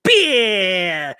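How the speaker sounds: long drawn-out comic battle cry; mock action-star yell. Capping the joke TV review, drawn-out mock yell. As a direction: cap it with a stretched silly yell.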